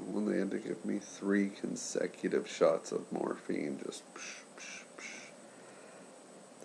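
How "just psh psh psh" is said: whispered psh. Retold or imitated. Imitating consecutive morphine shots.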